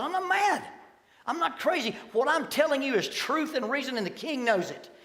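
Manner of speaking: Paul defending himself before the king; impassioned Paul. Acted. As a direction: cry out your innocence with fire. Paul's impassioned defense in court.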